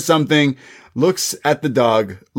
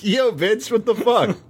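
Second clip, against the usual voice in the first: high, exasperated impression of Io; mock Io complaint; 10 semitones higher than usual. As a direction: whine at the boss in disbelief. Io voiced complaining to Vince.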